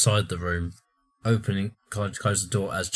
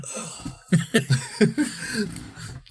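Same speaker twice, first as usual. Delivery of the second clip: airy unvoiced laugh; breathy laugh; 13 semitones higher than usual. Real, not acted. Laughing at the dying-man gag, airy and unvoiced. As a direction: laugh at your own joke.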